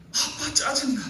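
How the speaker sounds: high, whiny daughter's complaint; daughter's outburst. Retold or imitated. Preacher voicing the daughter turning on her father, high and whiny. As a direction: blurt it like a fed-up teenage daughter. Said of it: "그걸 깨닫는 순간에".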